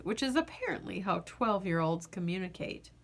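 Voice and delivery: usual speaking voice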